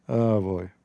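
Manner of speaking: usual speaking voice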